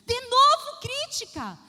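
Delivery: loud, high, slow offended retort; indignant snap. Retold or imitated. Wife retorts to husband's suggestion, offended and loud. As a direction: take offense and bite back.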